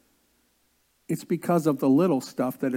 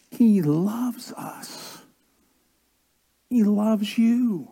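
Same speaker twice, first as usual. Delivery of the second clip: whisper; 4 semitones higher, much slower than usual. Real, not acted. Quiet sermon climax.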